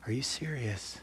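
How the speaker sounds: hushed amazement. Acted. Acting out rapt listening to Carrie.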